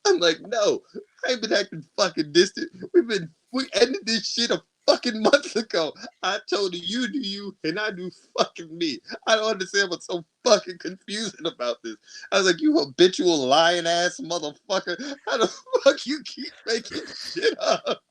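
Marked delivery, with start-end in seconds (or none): 0.4-12.0 high-pitched, exasperated rant; losing his shit. Retold or imitated. Setting his ex straight at the art event, exasperated rant. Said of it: "lose my shit in the middle". 12.6-18.0 high-pitched mock outrage; Shaft-style tirade. Cussing out his lying ex, hammed-up outrage. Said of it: "you start sounding like Shaft".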